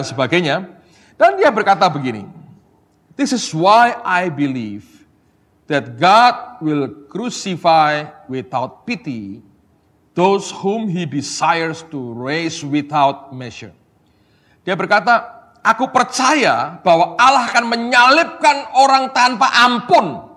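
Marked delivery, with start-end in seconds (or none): 3.1-13.7 very slow, measured English reading; solemn quotation reading. Acted. 15.6-20.2 high, slow pulpit proclamation; declaimed quotation. Acted.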